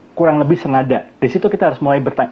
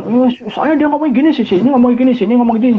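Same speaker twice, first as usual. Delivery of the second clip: much higher, whiny blaming voice, a bit louder; touchy complainer; 10 semitones higher, 3 dB louder than usual. Retold or imitated. Touchy friend blaming everyone around him, high whiny mimicry. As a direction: mimic someone whining that everyone else is to blame. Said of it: "tadinya dia bilang kan".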